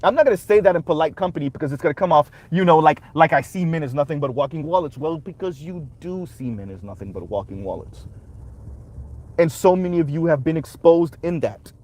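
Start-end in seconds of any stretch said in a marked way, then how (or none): 5.0-8.0 much lower and quieter retort; quiet, low-pitched comeback under the quote; low aside. Real, not acted. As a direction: drop into a low, soft aside. Muttered retort to the quoted excuse.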